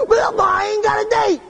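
put-on high, slow whining kid voice; whiny kid. Twelve-year-old whining to the preacher about the banquet, high and slow.

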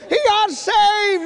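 high, loud, stretched holler; imitated joyful shout. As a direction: holler the news across the house. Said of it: "Daddy's shouting".

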